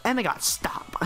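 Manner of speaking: high, hushed mock-camp voice; put-on camp exclamation; mock effeminate man. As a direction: imitate him fussing to his friends, high and soft. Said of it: "He wasn't talking to me like that".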